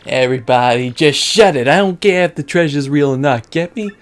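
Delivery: loud gangster boss shutting them up; irritated mob boss. Acted. Boss silencing his bickering henchmen, irritated loud mobster. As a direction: bark down the squabbling men.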